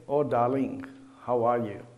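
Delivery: tender hello to a dear friend; loving greeting. Shown as a demonstration. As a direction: greet someone you love, gently. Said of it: "it means something nice".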